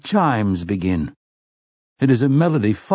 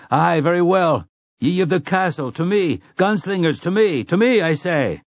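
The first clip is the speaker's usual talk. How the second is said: high rallying shout to the men; 7 semitones higher than usual. Acted. Roland rallies his last men with a high shout. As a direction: call the gunslingers to you, pitched high. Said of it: "he shouts".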